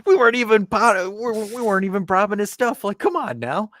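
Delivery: high, loud outraged whine; indignant mock protest. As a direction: protest loudly in a high, strained voice.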